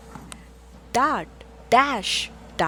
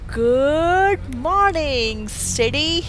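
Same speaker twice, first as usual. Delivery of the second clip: slow, loud, higher sing-song greeting; drawn-out greeting; 4 semitones higher, 6 dB louder, slower than usual. A narrator's reading. Reading aloud to children, a slow loud good-morning call. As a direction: stretch it out like a big hello. Book refrain greeting the city.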